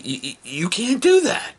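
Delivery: high stammering plea; high pleading stammer. Retold or imitated. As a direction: stumble over it pleading with her.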